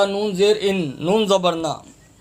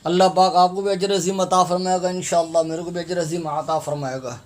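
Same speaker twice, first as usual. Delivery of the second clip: ordinary delivery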